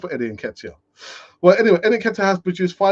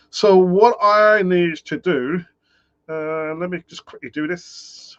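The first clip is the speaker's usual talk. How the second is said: odd voice; 3 semitones higher, 3 dB louder, slower than usual. Real, not acted. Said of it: "I'm not sure why I'm talking like this".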